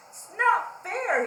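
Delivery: slow, high, loud whine; crying out. Acted. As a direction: wail it like a sulking pig in the mud. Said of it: "he cried".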